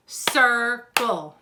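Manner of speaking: Circle split into two separate, firm beats; clapped-out syllables. Shown as a demonstration. Teacher clapping out the word for children, Circle in two beats. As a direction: break Circle in two, a gap between the syllables. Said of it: "let's try it again".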